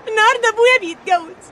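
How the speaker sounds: tearful, distraught outpouring; anguished crying. Acted. Girl telling how her mother died before her, anguished crying. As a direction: pour it out through tears.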